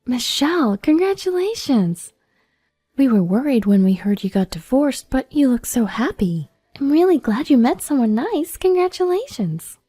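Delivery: high, excited friends' voice; delighted congratulations. Acted. Friends congratulating pregnant Michelle at the reunion, excited and high. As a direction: gush with happiness for a friend.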